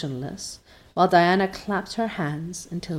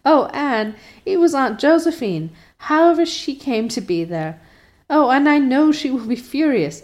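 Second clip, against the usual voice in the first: gasping with laughter; 8 semitones higher, 5 dB louder than usual. A narrator's reading. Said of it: "gasping with laughter".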